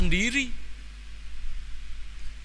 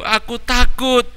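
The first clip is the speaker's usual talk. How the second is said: put-on higher child voice, tearful; a scared boy whimpering; crying child; 7 semitones higher than usual. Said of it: "Tony sambil menangis menjawab". (anak kecil takut, sedikit menangis)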